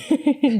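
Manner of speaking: short high-pitched laugh; real, spontaneous little laugh. Real, not acted. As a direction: laugh briefly at a shout-out. Laughs at shout out to Jerry.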